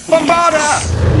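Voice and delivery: high, drawn-out posh spell cry; posh, high-pitched incantation; posh accent. Acted. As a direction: cast it in a really posh accent. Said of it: "say it with a really posh accent".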